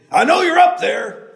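loud, high shout; old man shouting up at the hidden man, loud. Acted. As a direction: call it up into the trees. Landowner shouting up the trail.

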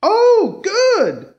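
high, loud burst of relief; relieved. A narrator's reading. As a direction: let the relief break out. Trooper relieved he's found.

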